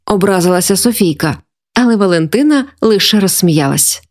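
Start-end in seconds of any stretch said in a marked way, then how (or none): none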